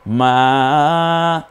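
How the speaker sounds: মা chanted with a long held vowel; stretched madd. Shown as a demonstration. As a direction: sustain the আ in মা. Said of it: "এক আলিফ টানা".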